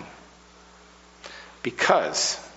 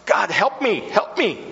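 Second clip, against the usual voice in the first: put-on high, breathless child's plea to God; a boy panting a prayer mid-sprint; 10 semitones higher, 3 dB louder than usual. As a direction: gasp it out like a boy running late. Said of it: "he panted".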